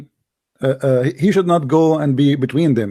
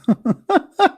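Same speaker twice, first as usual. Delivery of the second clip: high-pitched giggling burst; laughing; 11 semitones higher than usual. Real, not acted. Laughs at his own mockery.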